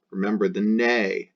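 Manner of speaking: normal talking voice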